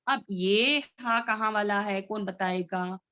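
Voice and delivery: ordinary talk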